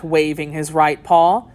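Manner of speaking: ordinary delivery, nothing marked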